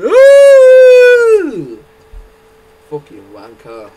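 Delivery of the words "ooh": high loud mock yelp of pain. Acting out the man hit on the head, high mock yelp. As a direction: squeal like he's just been whacked. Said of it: "He went".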